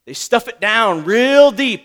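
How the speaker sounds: usual speaking voice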